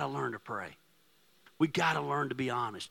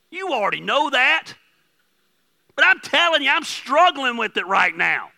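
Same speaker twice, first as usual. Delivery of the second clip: high, loud, urgent preaching; loud passionate preacher; fiery preaching; 10 semitones higher, 10 dB louder than usual. Acted. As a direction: preach it out to the back row. Preaching at full force.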